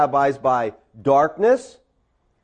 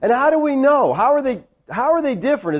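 raised-pitch baffled questions; incredulous; 7 semitones higher, faster than usual. Acted. Teacher asking how worldly clothes differ, incredulous. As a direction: press the point in disbelief.

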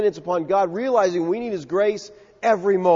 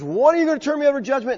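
fast, higher, disbelieving; incredulous question; 8 semitones higher, much faster than usual. Retold or imitated. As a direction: toss back the accusation in shock. Disciples' disbelieving reaction retold.

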